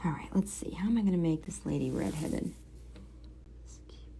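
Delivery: whisper. Real, not acted. Quiet focus while drawing.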